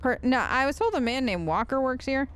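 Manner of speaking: ordinary delivery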